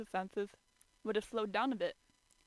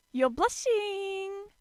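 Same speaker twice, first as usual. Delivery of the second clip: high, slow, teasing lilt; gleeful accusation; 11 semitones higher, 8 dB louder, much slower than usual. A narrator's reading. Shigure delighted catching Kyo blushing, teasing lilt. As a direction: savor the tease. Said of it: "the dog accused".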